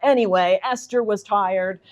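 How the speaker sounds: a lightly hammed-up storybook narrator voice, slowing at the end; mock grand novel narration. Reading a mock line from the novel to the audience, lightly hammed-up narration. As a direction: read it like a solemn Victorian narrator, then undercut it. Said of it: "there'll be a line like".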